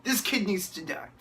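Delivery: exasperated disbelief. Retold or imitated. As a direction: roll your eyes at the screen. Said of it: "I'm just like".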